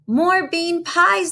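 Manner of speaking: hammed-up giant demand; giants shouting. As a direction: chant it like a crowd of hungry giants. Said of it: "they shouted".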